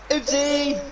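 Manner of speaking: high, sing-song little oopsie; cutesy oopsie. Real, not acted. Playful oops after swearing.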